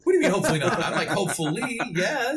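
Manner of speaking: high, laughing mock protest. Real, not acted. Joking with the host about his nude scene, laughing and high. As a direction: tease back through a laugh.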